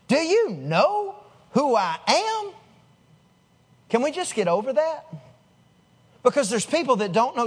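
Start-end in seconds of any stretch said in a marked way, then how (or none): 0.1-2.5 slow, higher-pitched mock indignation; self-important challenge. Believer who was treated badly reacts, pompously indignant. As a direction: stretch each word as if outraged at not being recognised. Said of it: "your knee-jerk response is".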